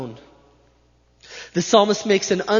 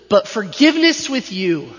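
"But forgiveness with you" higher and louder; emphatic; 4 semitones higher, 6 dB louder than usual. Shown as a demonstration. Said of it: "It's emphatic, it's definite, it's present".

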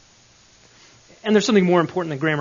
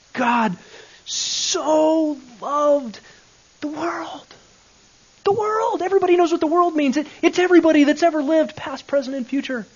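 high, rising, ever louder preacher voice; mock preacher; 10 semitones higher, 6 dB louder than usual. Mimicking the preachers he grew up hearing, high and loud. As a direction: ham up the old-style sermon, building to a big loud finish. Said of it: "we grew up hearing".

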